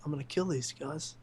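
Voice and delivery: put-on furious girlfriend woken at night, soft deadpan threat; emotionless threat. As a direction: say it under your breath like a silent fury. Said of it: "standing there emotionless".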